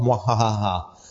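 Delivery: evil mwahaha cackle, lightly done; a hint of a cackling villain; supervillain laugh. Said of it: "That was supposed to be a supervillain laugh".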